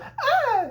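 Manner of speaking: short, very high startled shriek; startled mock scream. Alice waking from a dream, high mock scream. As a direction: let out a hammed-up high yelp.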